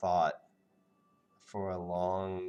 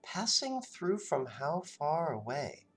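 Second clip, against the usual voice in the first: suspicious Natasha; 9 semitones higher than usual. Acted. GM voices Natasha probing.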